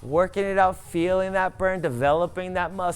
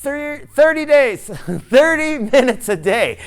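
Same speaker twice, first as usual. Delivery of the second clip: louder and higher, a laugh breaking in; laughing rally call; 6 semitones higher, 9 dB louder than usual. Real, not acted. Coach cheering the class on, laughing, loud and higher. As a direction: shout it through the laugh.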